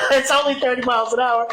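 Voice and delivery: mocking his own excuse through a laugh; laughing self-mockery. Laughing at his own excuse, mocking it.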